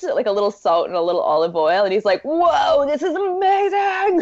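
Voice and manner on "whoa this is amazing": much higher, hammed-up awe; mock amazed dad. Dad marveling at girlfriend's cooking, hammed-up amazement. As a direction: oversell the wonder like a besotted dad. Dad raving over bland food.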